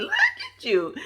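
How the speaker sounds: very high and laughing; squealed laugh of approval; giggly squeal. Retold or imitated. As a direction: tease her with delight. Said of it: "I was like".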